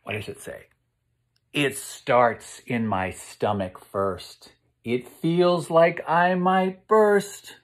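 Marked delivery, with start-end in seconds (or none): 1.5-7.6 slow rhyme, rising higher on the second line; storybook rhyme. A narrator's reading. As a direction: build the tension slowly. Reads the rising-anger rhyme.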